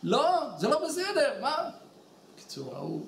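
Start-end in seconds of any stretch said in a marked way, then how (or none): 0.0-1.5 mock indignant protest. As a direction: imitate an angry customer objecting. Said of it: "למה אתה צועק".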